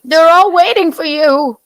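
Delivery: high, loud mock call; mock shouting. Host hurrying someone along in a bit, hammed-up yell. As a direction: holler it in a high pitch.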